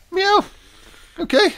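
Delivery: high, loud meow back at the cat; playful cat meow; mock meow. Real, not acted.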